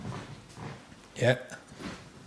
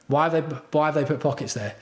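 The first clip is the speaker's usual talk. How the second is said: normal talking voice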